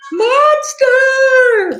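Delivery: high sung loud cheer. Acted. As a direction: cheer the name. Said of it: "They all cheered together".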